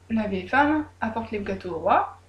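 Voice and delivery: usual speaking voice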